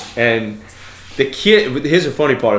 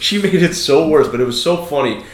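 ordinary talk, nothing marked

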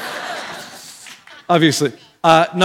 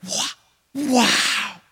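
breathless, whispery astonishment; hushed, dumbfounded wow; amazed; 4 semitones higher, 8 dB quieter than usual. Acted. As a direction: stammer it softly, struck with awe. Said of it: "They would be like".

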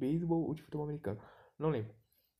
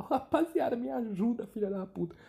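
high yelled plea for help, fading down; loud cry for help easing off; desperate shout; 8 semitones higher, 4 dB louder than usual. Acted. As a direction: scream into the radio for help, then sag. [name] radios police for help.

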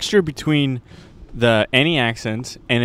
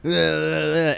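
drawn-out wordless mumbling; homeless man's babble; 3 semitones higher, much slower than usual. Retold or imitated. Homeless guy walking into a store, imitated incoherent babble. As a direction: imitate incoherent noises, "ugh, ugh, ugh". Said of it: "a homeless guy would walk in and be like".

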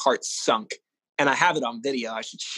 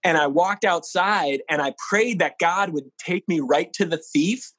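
ordinary delivery

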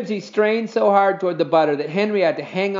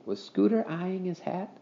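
ordinary delivery, nothing marked

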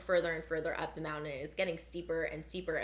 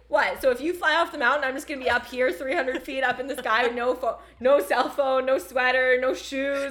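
mock panic rant; 6 semitones higher, 10 dB louder than usual. Retelling her panicked refusal.

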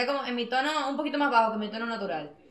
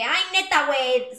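exaggerated Mexican "Ay, neta, güey", pitched way up and louder; Mexican accent; 3 semitones higher, 5 dB louder, slower than usual. Shown as a demonstration. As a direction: overdo the Mexican slang on purpose. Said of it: "tipo de que".

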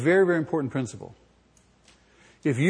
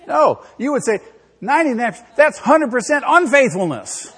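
loud, high mock outrage; indignant wife; 8 semitones higher, 9 dB louder than usual. Wife to husband, mock outraged, high and loud. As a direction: fire back like an offended wife.

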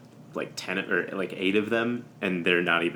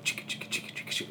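soft unvoiced chicka chicka; funk guitar imitation; 10 dB quieter, much faster than usual. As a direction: whisper a wah-wah funk riff. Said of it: "where it just goes, like".

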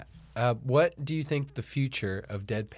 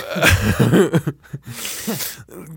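loud burst of laughter; 6 semitones higher, 8 dB louder than usual. Real, not acted. Laughs at killed neurons joke.

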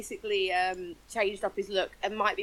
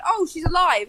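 mock surprise; 6 semitones higher, 5 dB louder than usual. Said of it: "and then it's like".

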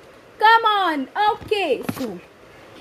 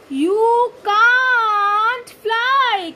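drawn-out emphasis; 5 semitones higher, much slower than usual. Shown as a demonstration. Teacher stressing rule.

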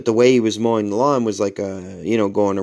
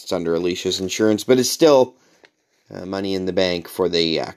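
normal talking voice

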